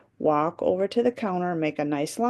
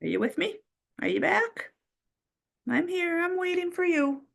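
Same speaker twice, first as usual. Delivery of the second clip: high sing-song call; 12 semitones higher than usual. Real, not acted. Teacher calling the class back, high sing-song.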